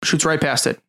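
ordinary talk

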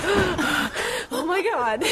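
gasp, then high drawn-out awe; hammed-up breathless fan; mock starstruck gasping. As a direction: act out being overwhelmed, very slowly. Acts out backstage starstruck panic.

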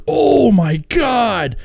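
long, high mock gasp of amazement; awestruck audience. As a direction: drag it out like a wowed crowd. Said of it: "the audience will be like".

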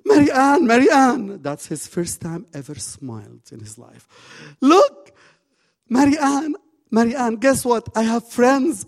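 very high, loud joyful calling; overjoyed shout. Retold or imitated. Lonely boy running to the counselor, shouting with joy. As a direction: call out bursting with happiness. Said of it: "Yahya ran to Mary Ann and he said".

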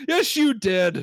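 high, indignant British customer; hammed-up British complainer; British accent. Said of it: "We're gonna try our British accents".

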